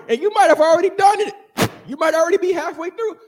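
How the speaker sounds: fast, loud, high preaching shout. Real, not acted. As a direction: drive it home, rising and loud.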